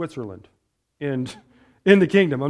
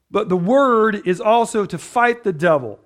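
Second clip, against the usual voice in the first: ordinary talk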